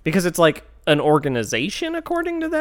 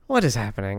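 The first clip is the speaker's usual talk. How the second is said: ordinary delivery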